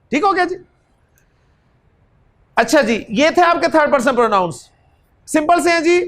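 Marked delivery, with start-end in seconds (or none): none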